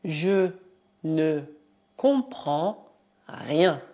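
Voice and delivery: "je ne comprends rien" said word by word, slowly; teacher dictating slowly; slow dictation. Shown as a demonstration. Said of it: "Por exemplo".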